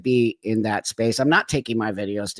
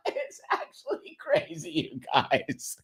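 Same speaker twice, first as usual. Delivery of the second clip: laughing through the words, softer as it goes; 5 dB quieter than usual. Real, not acted. Host laughing with viewers at how crazy it is, laughing. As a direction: say it through helpless real laughter. Laughing at the absurd banned word.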